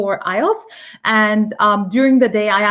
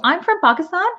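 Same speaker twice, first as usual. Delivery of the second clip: clipped reply, pitched up on from; short curt answer; 9 semitones higher than usual. Shown as a demonstration. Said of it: "sorry, four words".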